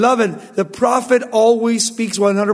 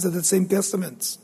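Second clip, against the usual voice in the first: ordinary talk, nothing marked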